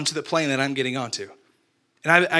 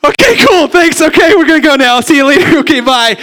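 much higher, much louder embarrassed babble; flustered high squeak; 10 semitones higher, 13 dB louder than usual. Retold or imitated. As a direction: squeak out an awkward goodbye. Flustered after being turned down.

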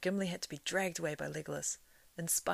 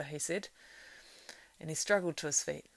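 usual speaking voice

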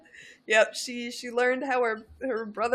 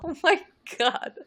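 shocked; 7 semitones higher than usual. Real, not acted. Shocked reaction.

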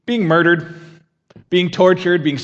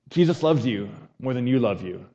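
usual speaking voice

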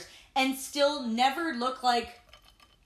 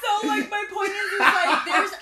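laughing; 9 semitones higher, 8 dB louder than usual. Real, not acted. Still laughing, regaining composure.

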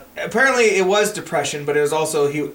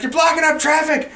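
high-pitched, laughing mock rant; angry comic rant; 10 semitones higher, 4 dB louder than usual. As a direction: shriek it out as a cranky comic, cracking up. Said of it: "he's like".

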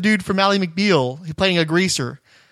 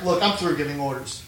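resigned; 3 semitones lower, 5 dB quieter than usual. Shown as a demonstration. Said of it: "he's just like".

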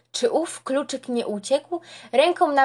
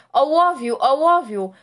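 insistent calling; 3 semitones higher, 3 dB louder than usual. A narrator's reading. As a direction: keep calling out urgently. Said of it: "Asesor woła ciągle". (asesor woła głośno i natarczywie o ołów)

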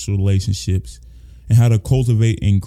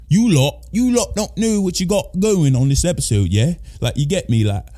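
badly faked London accent, pitched up; poor mock British accent; 6 semitones higher than usual. Podcast host teasing listeners about the episode, bad British accent. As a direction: put on a hammed-up British accent. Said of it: "piss poor accent".

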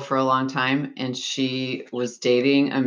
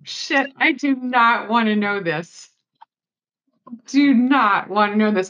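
mock horror; 9 semitones higher than usual. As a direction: say it like you're recoiling. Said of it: "so I go".

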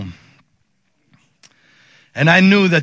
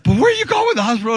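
high-pitched startled question; alarmed wife; 7 semitones higher, 3 dB louder, faster than usual. Retold or imitated. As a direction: imitate a wife demanding answers. Wife questioning him in the car.

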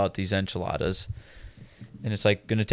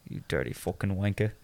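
mock British accent; 7 dB quieter than usual. Showing friends British slang, mock British accent. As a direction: play a gruff-talking Brit, quietly.

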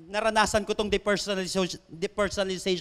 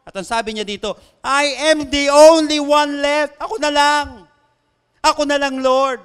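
loud, raised-pitch prophet's plea; impassioned scripture preaching; 4 semitones higher, 8 dB louder than usual. Acted. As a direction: cry out Elijah's complaint to God in a preacher's voice. Reads Elijah's complaint.

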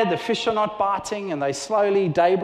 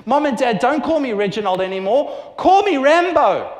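Reginald demanding; 4 semitones higher, 6 dB louder than usual. Retold or imitated. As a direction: boldly demand to be called Rambo. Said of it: "he turns around to you and he says".

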